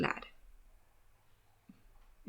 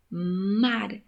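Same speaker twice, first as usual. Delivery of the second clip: normal talking voice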